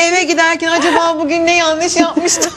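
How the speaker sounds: high, laughing delivery. Real, not acted. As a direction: laugh through the line. Laughing at childhood memory.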